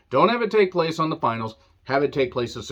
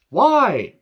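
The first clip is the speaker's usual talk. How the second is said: indignant why; 9 semitones higher, 6 dB louder than usual. Real, not acted. Outraged question.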